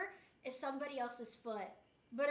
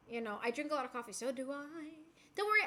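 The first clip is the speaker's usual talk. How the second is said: ordinary talk, nothing marked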